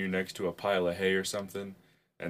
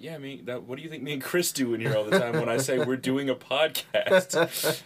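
mock indignant retort; 6 semitones higher, 4 dB louder than usual. Retold retort to doubting question.

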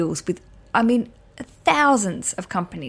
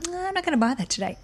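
quick, higher, softer voice of a shopper declining; mock shopper; 8 semitones higher, 5 dB quieter, much faster than usual. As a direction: brush it off like a shopper changing their mind. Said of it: "and then you go".

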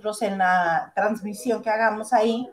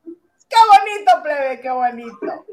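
mock delight; 4 semitones higher, 8 dB louder, slower than usual. Real, not acted. Sarcastic exclaim at news.

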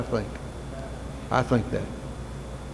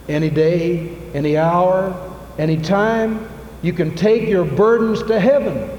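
building sermon; 7 semitones higher than usual. Acted. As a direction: lift the congregation, climbing with each phrase. Preaching builds up.

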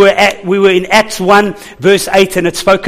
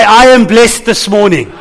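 louder, upbeat declaration of being blessed; positive attitude; 2 semitones higher, 4 dB louder than usual. Shown as a demonstration. Pastor leading the church in a response, louder and upbeat. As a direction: proclaim it like you mean it, full of faith. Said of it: "say it with a positive attitude".

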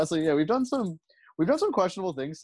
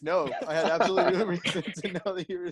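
quoted line breaking into laughs; laughing; 4 semitones higher, faster than usual. Real, not acted. Laughing while quoting.

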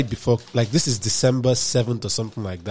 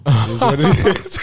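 laughing; 4 semitones higher, 9 dB louder than usual. Real, not acted. Laughing off toxic job.

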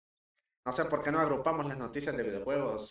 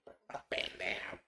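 whispering; 5 semitones lower, 4 dB quieter, slower than usual. Real, not acted. Teasing aside to co-host.

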